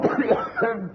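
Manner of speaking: high, choking cough on gas. Acted. Inspector choking on gas, coughing hard.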